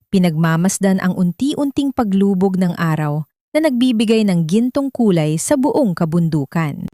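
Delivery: plain reading voice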